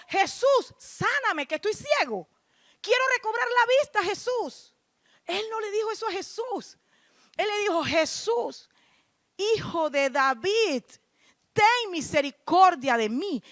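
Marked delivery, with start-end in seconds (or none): 0.1-4.8 imitando a un ciego que clama, voz muy aguda; grito de súplica. Acted. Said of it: "Bartimeo le gritó". 7.8-13.4 súplica proclamada, lenta y marcada; clamor actuado. Acted. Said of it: "Bartimeo le gritó".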